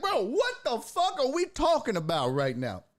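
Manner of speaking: high-pitched, worked-up protest; exasperated disbelief. Acted. Motorist confronting the tow driver, exasperated. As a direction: demand sense from a baffling claim.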